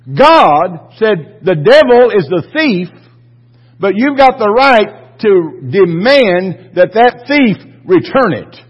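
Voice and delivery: fired-up pastor demanding justice; preaching. Real, not acted. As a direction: drive every word home to the congregation. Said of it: "preaching at full force".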